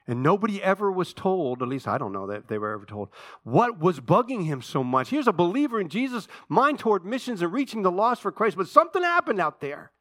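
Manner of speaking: high, loud, driving preacher's cry; full-force pulpit preaching, pitch and volume way up; fiery preaching. Acted. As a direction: preach it hard to the whole room.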